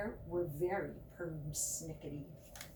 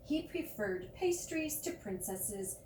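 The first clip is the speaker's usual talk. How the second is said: plain reading voice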